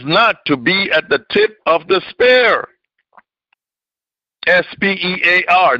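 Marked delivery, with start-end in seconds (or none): 4.5-5.8 "S P E A R" spelled letter by letter. Shown as a demonstration. Preacher spells "S P E A R" out to the congregation letter by letter. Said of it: "not this spear".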